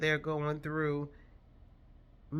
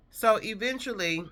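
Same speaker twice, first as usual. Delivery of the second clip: usual speaking voice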